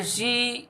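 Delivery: सी drawn out long; stressed syllable demo. Shown as a demonstration. Teacher pointing out the stressed part, long drawn सी. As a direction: hold the vowel of सी. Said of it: "इस पे हमें ज़्यादा जोर लगाना पड़ रहा है".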